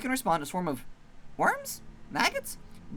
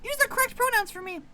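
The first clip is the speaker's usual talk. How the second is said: much higher, louder squeaky threat; squeaky tiny maggot; 5 dB louder than usual. Acted. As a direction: squeak a tough-guy warning.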